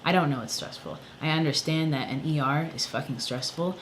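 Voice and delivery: normal talking voice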